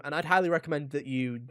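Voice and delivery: ordinary delivery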